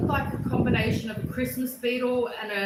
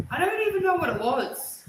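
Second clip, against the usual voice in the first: triumphant; 7 semitones higher, 4 dB louder than usual. Retold or imitated. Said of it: "And I'm like".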